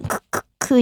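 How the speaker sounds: quiet voiceless kuk-kuk; hushed soundless laugh; unvoiced laugh. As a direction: breathe out a stifled chuckle. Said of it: "って、特に面白かった時に笑っちゃう".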